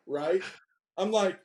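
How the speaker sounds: ordinary talk, nothing marked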